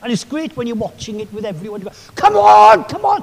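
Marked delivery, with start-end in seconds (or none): 2.2-3.2 loud cheering from the pub; rugby fan shouting. Retold or imitated. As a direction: yell at the screen to urge your team on. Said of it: "Are you screaming and shouting?".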